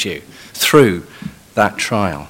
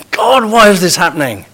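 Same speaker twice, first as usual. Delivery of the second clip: indignant demand at God; 8 semitones higher, 4 dB louder than usual. Shown as a demonstration. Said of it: "the other is doubting the very goodness".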